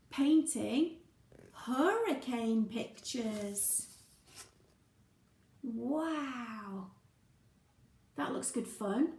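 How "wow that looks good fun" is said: ordinary delivery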